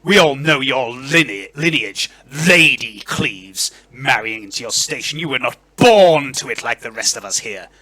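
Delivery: loud, haughty disdain; indignant aristocrat, stressing key words; Lord Verence. Acted. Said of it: "Lord Verence's voice raises".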